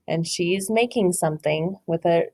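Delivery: ordinary delivery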